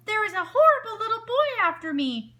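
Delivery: high, loud panicked yell; desperate now, no trick; yelling for help. A narrator's reading. Wolf calling for help, real loud panic. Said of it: "he yelled".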